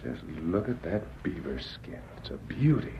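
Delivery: breathy whisper of admiration. Acted. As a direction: whisper it in awe.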